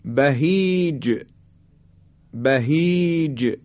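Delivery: "بهیج" slowly drawn out, ج released with a bounce; qalqalah on ج. Shown as a demonstration. Teacher models the letter jim in "بهیج", bouncing qalqalah. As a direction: stretch the ī of "بهیج", then bounce the ج. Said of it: "حرف جیم".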